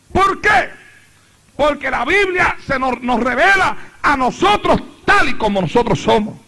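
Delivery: loud, high, driving Pentecostal preacher; preaching at full force. Real, not acted. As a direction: thunder it out to the congregation, phrase after phrase. (predicación a toda voz, con fervor)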